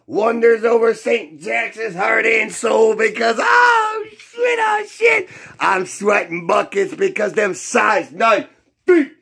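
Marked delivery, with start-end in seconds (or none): 3.4-4.6 high yelping oh shit; excited shout. Real, not acted. Fan shouting high in excited delight. Hyped fan tribute, sudden outburst. 4.6-5.3 high yelp landing down on shit; excited shout. Real, not acted. Fan yelping high in excited delight, falling away. Hyped fan tribute, sudden outburst.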